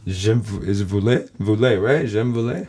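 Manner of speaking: unsure American accent in French; groping for French words, American accent; foreign accent in French. Real, not acted. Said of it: "my French stinks".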